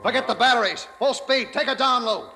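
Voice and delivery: high, urgent commands barked over the alarm; urgent shouted orders. Acted. Captain yelling to his crew to crash dive, urgent shout. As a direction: yell the dive order before the destroyer hits.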